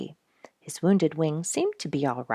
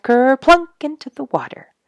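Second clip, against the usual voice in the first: higher, louder splashy dive; playful splash; 9 semitones higher, 9 dB louder than usual. A narrator's reading. Reading to children, a loud high dive splash. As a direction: let the splash pop out. Otter dives into duck pond.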